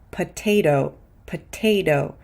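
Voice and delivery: "potato" said like "puh-TAY-doh"; crisp first T, tapped D for the second in "potato"; T then D. Shown as a demonstration. Teacher models "potato" with stressed T, then a D. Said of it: "in the unstressed syllable is a D".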